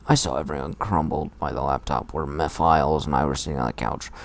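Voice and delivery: ordinary talk, nothing marked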